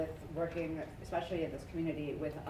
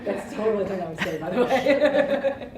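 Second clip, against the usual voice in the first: speaking through laughter, getting louder; laughing; 3 semitones higher, 10 dB louder than usual. Real, not acted. Colleague admits it through laughter to the group.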